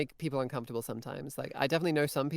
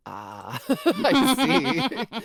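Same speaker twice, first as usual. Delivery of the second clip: knowing laugh; 9 semitones higher, 9 dB louder, much slower than usual. Retold or imitated. Hearing it's Liverpool dialect, knowingly laughing. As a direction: laugh through it as the penny drops.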